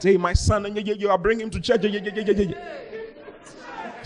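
high, excited mother gushing yeah yeah; high gushing mock mother; mother's voice. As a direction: mimic the mother rattling on proudly. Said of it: "the mother started talking in a certain way".

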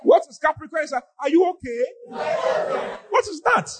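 climbing high into disbelief; baffled scolding, pitched well above normal; incredulous challenge. Real, not acted. Preacher questioning believers in Capricorn, incredulous.

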